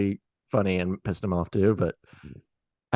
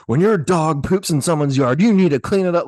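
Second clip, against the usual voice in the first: mock yell, pitched up and forceful; aggressive yelling; 7 semitones higher, 4 dB louder than usual. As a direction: imitate an angry man's scolding, higher and louder. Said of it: "he starts aggressively saying".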